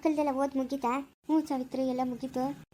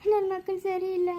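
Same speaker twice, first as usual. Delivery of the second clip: tearful lament, higher-pitched with sobs between phrases; weeping, voice pitched up, halting between phrases; crying; 4 semitones higher than usual. Acted. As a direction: pour out the heartbreak through sobs. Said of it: "ಅಳ್ಬೇಡಿ ಸುಮ್ನೆ ರೀ ಅಖಿಲಮ್ಮ".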